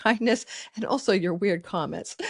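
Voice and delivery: usual speaking voice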